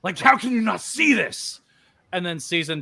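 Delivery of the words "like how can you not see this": high, loud anguished shout; character shout. Acted. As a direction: shout it at someone who won't see the truth. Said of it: "You know, like".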